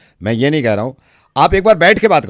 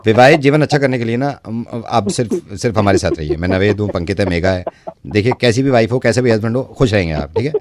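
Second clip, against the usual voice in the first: दर्शकों से सीधे, नीची आवाज़ में प्रचार; सलाह बेचने वाले विज्ञापन की तरह कहो; विज्ञापन जैसी घोषणा; 7 semitones lower than usual. Acted. (दर्शकों को अपने शो की ओर बुलाते हुए, हल्का विज्ञापन स्वर)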